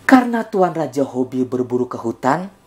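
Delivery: usual speaking voice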